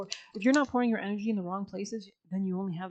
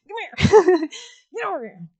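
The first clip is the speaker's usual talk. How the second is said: much higher and louder, laughing through it; hammed-up calling out; 10 semitones higher, 15 dB louder, slower than usual. Spirit guides to the viewer, loud laughing call. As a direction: holler for them like calling a dog across the yard.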